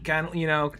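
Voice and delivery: ordinary talk, nothing marked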